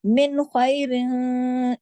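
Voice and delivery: slow chanted मिन खैरिन; recitation demo. Shown as a demonstration.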